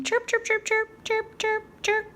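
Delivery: high chirping bird calls; bird chirps. Pretend. Teacher making bird sounds for the kids, high chirps. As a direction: chirp it like a little songbird.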